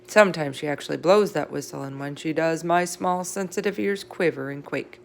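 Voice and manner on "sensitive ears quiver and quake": usual speaking voice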